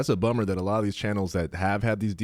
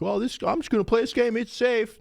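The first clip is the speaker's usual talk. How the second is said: high, fast carefree voice; naive gamer voice; 14 semitones higher than usual. Retold or imitated. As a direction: sound clueless and sure. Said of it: "they think".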